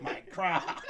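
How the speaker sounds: laughing, breathy mock lament; mock wail. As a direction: cry out for the lost croc, half-laughing. Wailing over lost shoe while laughing.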